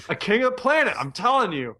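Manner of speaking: playfully insisting on the name; insistent joke pitch. Real, not acted. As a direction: sell the silly name.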